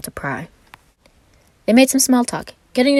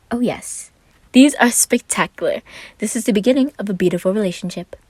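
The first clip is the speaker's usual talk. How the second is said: lightly voiced Roman; 5 semitones higher, 4 dB louder than usual.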